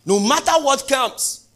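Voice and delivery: high, loud, slow declaration; fervent shout. Acted. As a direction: belt it out like a vow. Preacher peaks.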